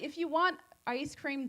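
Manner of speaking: normal talking voice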